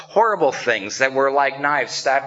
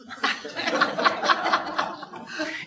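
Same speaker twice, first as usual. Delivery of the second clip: laugh; 5 dB quieter than usual. Real, not acted. Laughs at pastor's joke.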